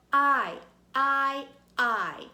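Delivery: I said loud as "eye", like ice; long I sound. Shown as a demonstration. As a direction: belt out I as the letter name. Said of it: "holding my ice cream cone".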